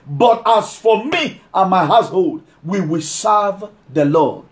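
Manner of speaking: drawn out, every phrase weighted; slow scripture reading. Acted. Preacher to congregation, reading Joshua slowly. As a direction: read the verse slowly like a proclamation.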